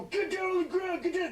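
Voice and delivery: high, loud hammed-up command; mock cop yell. Cops arresting the boy, loud mock shouting. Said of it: "And then the cops go".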